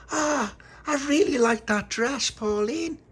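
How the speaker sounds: put-on high, slow, gushing child voice; gushing admiration. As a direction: coo over a friend's dress, high and loud. Child gushes over friend's dress.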